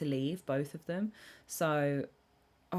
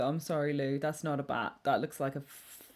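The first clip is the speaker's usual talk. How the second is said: ordinary talk, nothing marked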